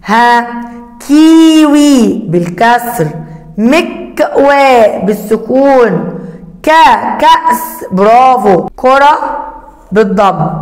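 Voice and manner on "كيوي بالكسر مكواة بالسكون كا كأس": كيوي and مكواة stretched slowly; teacher sounding out كاف words slowly. Shown as a demonstration. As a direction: draw the words out for children. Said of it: "انطق وصل صوت حرف الكاف".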